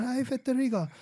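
put-on Tomo Fujita's Japanese accent. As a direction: imitate a Japanese guitar teacher's accent. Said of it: "In his Japanese accent".

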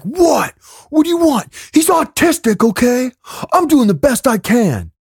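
high-pitched exasperated outburst; dad flipping out. Retold or imitated. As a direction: imitate a dad losing it at his kid. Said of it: "and then dad flips out".

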